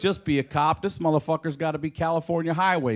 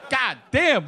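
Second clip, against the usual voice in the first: excited exclaim; 8 semitones higher, 4 dB louder than usual. Retold or imitated. Stoned comic thanks the weed.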